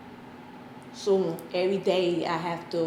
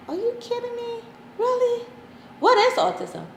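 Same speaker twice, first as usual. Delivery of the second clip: high-pitched incredulous questions, growing louder; retold disbelief; 4 dB louder than usual. Pregnant mother reacts to her son's diagnosis, incredulous.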